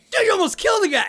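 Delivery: high, loud mocking jab; teasing friend. Friend teasing the driver about the biker, high and loud. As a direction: rib your buddy mercilessly. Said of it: "No, I was like".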